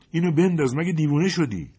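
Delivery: high, loud exasperated yell; urgent shout. Acted. Soldier yelling at a stubborn comrade under fire, angry shout. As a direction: snap at him to drop it now. Soldier urges dropping the suitcase.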